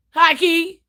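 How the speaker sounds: ordinary delivery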